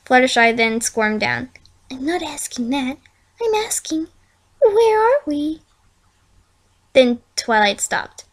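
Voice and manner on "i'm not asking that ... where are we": frightened pony in the dark forest; from a hushed whisper to a high worried plea; scared whisper. A narrator's reading. Scared Fluttershy in the woods.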